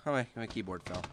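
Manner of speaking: ordinary talk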